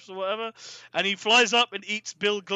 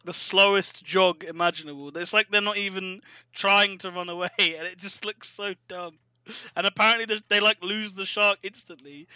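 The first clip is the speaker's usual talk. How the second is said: normal talking voice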